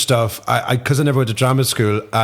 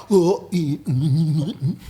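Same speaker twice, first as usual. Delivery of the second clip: titchy mate's character; 4 semitones higher, much slower than usual. Retold or imitated. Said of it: "So if I ever do his character".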